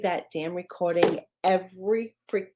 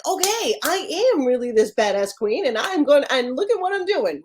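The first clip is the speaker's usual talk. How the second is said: pumped up; 7 semitones higher, 3 dB louder than usual. Retold or imitated.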